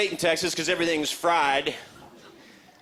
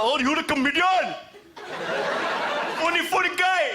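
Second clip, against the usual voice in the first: mock-impressed, much higher, sing-song; 11 semitones higher than usual. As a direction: tease the comedian in a high put-on voice. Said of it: "They do that".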